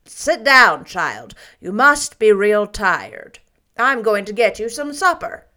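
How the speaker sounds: brisk motherly fussing; a hint of a gruff, caring aunt; Marilla's voice.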